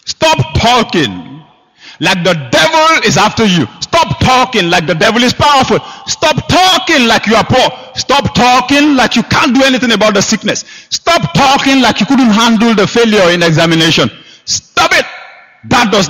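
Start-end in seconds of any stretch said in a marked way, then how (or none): 0.0-14.1 rising preacher's exhortation; preaching. Acted. Preaching at full force. 14.4-15.0 sharp command. Acted. Preacher rebuking, sudden high.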